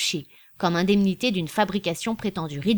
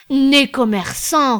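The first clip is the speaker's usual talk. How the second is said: higher, much louder and slow; emphatic repetition; 6 semitones higher, 9 dB louder, slower than usual. A narrator's reading. Birotteau repeating his praise of Anselme, loud and slow. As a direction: repeat it with relish. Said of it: "répéta Birotteau".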